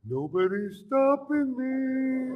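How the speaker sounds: high, slow, loud yell; a boarding-the-yacht battle cry; triumphant yell. Retold or imitated.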